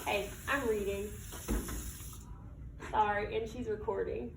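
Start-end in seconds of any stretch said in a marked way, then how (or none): none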